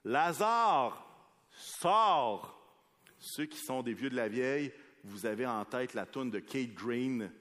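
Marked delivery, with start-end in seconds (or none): 0.0-2.6 called out high and loud; a commanding shout into the grave; loud cry. A narrator's reading. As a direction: call Lazarus out of the tomb. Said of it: "il cria d'une voix forte".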